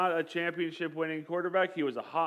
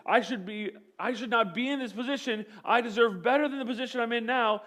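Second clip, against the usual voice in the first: higher, aggrieved protest; indignant complaint; 6 semitones higher than usual. Retold or imitated. Quarterback protesting his position to the coach, indignant. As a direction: insist you deserve better. Freshman feels disrespected, complains.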